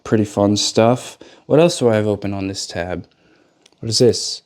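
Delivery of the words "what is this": ordinary delivery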